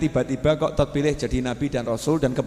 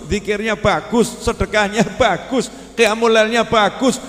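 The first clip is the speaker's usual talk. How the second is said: raised preaching voice listing deeds; warning sermon; 8 semitones higher, 4 dB louder than usual. Acted. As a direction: build the list toward the warning.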